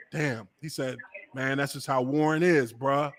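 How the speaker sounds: low-pitched, deflated imitation; resigned, sighing. Host imitates his friend excusing the player, resigned. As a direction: drop the voice as if giving up. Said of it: "I just heard him sigh".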